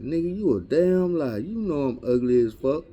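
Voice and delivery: high-pitched indignant retort, acted out; mock indignation. Ugly date scolding the homeboy on a three-way call, mock indignant. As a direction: play an offended caller telling someone off.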